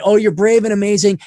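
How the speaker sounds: high, loud sugary mock flattery; mocking sweet-talk. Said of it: "tell you what you wanna hear".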